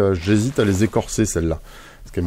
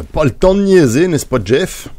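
put-on a weak, louder stab at a Québécois accent; failed Québécois accent; 7 semitones higher, 5 dB louder than usual. Builder teasing Jeff in a half-hearted Québécois accent. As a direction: try a Québécois accent and miss. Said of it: "mon accent québécois était nul".

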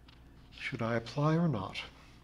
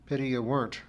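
plain reading voice

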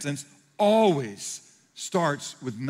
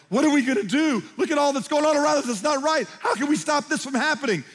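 higher, louder panicked voice; worldly sorrow; 10 semitones higher, 5 dB louder than usual. Retold or imitated. Pastor mimics worried believers, mock panic. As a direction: act out anxious worrying people. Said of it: "not filled with worldly sorrow, like".

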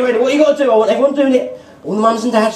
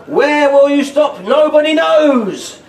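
slow mock suspense; 5 semitones higher, slower than usual. Spinning pencil suspense.

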